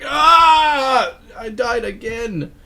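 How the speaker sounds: high, loud cry then slow whine; imitating a cheesy game death scream. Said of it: "that death scream".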